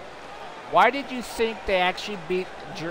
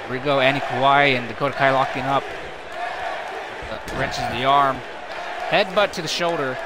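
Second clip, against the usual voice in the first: brisk, running wrestling call; play-by-play commentary; 2 semitones lower than usual. Acted. Commentator calling the match for viewers, brisk play-by-play. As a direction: keep the action rolling like a ringside caller.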